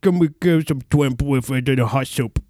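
slurred, garbled Creole parody; mock Creole accent. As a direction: mangle it like a tongue-stung Creole diner. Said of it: "That's just the Creole accent".

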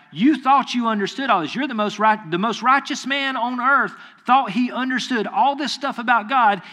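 a voice of God taking Job to task; God challenging. Retold or imitated.

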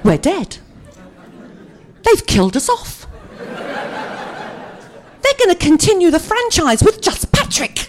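slow, climbing laughing alarm; mock panic. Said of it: "I'm panicking".

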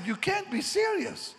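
high, incredulous cry; shocked disbelief. Retold or imitated. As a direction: sound stunned by the demand. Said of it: "I said".

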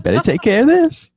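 squeaky mock voice; high silly voice. High joking voice.

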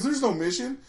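mock deadpan punchline; Robin's reveal. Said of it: "he goes".

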